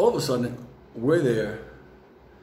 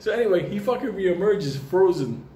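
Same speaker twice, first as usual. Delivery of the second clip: usual speaking voice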